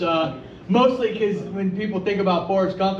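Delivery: ordinary talk, nothing marked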